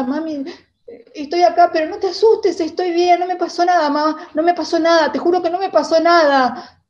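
loud, high, insistent reassurance; reassuring call. Retold or imitated. Hurt girl calling to reassure her frantic mother, loud high call.

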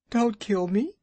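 high pleading squeak of a frightened muskrat; almost weeping. Acted. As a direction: beg for your life, close to tears. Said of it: "almost weeping".

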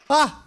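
a sudden high yelp of alarm; startled yelp. Real, not acted. Gamer yelps at a sudden scare, high startled cry. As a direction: jolt out a short high cry.

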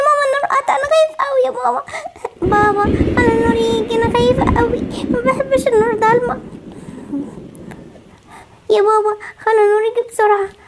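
put-on high, whimpering little-kid voice; tearful scared child. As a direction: cry to mama and papa, afraid of the dark. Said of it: "حبيبتي ما تعيطيش خلاص". (صوت طفل خايف بيعيط)

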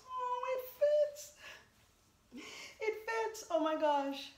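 high squealing delight; thrilled squeal. Real, not acted. Vlogger finds the outfit fits, delighted squeal. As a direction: squeal it, disbelieving joy.

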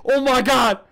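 loud, high panicked cry; real alarmed yell; startled fright. Real, not acted. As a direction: cry out right after a jump scare. Clown just jumped out at him.

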